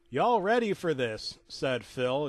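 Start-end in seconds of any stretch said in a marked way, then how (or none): none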